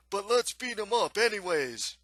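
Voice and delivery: rowdy thug's rallying call; rowdy monster hunter. Acted. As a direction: egg the gang on loudly. Hunter urges the others to attack.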